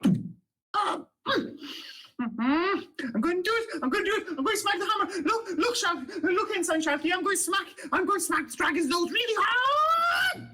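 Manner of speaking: put-on high, laughing shouts of a thrilled young guard; excited halfling. Halfling guard shouting to Ensign Shalky, thrilled and high. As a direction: shout it out, bursting to show off. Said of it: "one who speaks like this and speaks a lot".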